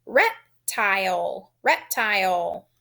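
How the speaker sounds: slow "reptile", split as rep... tile; syllable by syllable. Shown as a demonstration. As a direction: dictate "reptile" slowly, both syllables full. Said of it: "Think about the two syllables".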